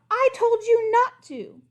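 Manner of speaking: high, slow exasperated scold. Acted. Princess scolds the crocodile, high and exasperated.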